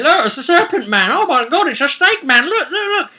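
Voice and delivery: high, excited carnival barker showing off a snake man; squealing tourist pointing at a monster; mock show-off. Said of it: "drag him out and say".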